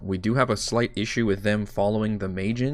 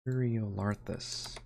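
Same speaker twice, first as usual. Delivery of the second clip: muttered practice; 10 dB quieter, slower than usual. Shown as a demonstration. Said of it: "Maybe that'll help me".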